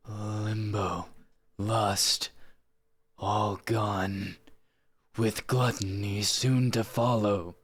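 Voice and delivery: lightly acted villain monologue, slowly drawn out; Gabriel. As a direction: attempt a slow, deliberate game-character speech. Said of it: "I'll, I'll try it".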